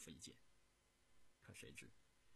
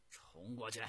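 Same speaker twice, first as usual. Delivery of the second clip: loud, raised battle order; fierce commander ordering a charge, building louder; gritted-teeth fury; 4 semitones higher, 10 dB louder than usual. A narrator's reading. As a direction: shout the attack through clenched teeth. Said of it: "咬牙切齿地说".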